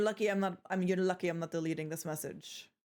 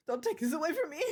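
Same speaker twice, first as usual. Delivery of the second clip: mock pleading; 8 semitones higher, faster than usual. Real, not acted. Pleading with Chloe over league.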